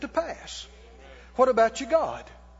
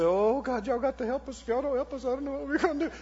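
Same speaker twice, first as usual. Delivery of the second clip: softer, hurried mock pleading; hammed-up desperate plea, kept quiet; mock begging; 2 semitones higher, 4 dB quieter, faster than usual. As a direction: imitate someone crying for help. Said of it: "come to you and cry and beg".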